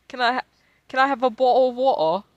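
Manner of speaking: high, loud mock-British, dropped T's; British "bottle of water". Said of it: "you guys don't pronounce your T's".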